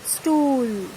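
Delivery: Stool with one long oo, falling; elongated vowel. Shown as a demonstration. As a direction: stretch the oo in Stool, no h sound. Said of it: "only for elongating the sound".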